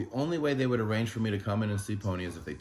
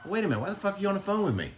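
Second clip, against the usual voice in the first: retold outrage; 12 semitones higher, faster than usual. Retold outburst at the doctor.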